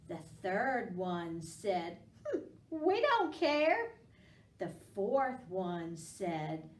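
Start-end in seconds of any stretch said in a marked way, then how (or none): 2.3-3.9 high, loud don't-care shrug; carefree pumpkin. Acted. Teacher playing a careless pumpkin, high and breezy. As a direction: toss it off like you couldn't care less.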